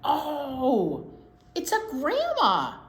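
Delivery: bright, rising surprise; excited oh. Real, not acted. Teacher delighted by child's answer, excited.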